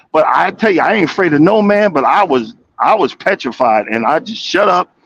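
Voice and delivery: ordinary talk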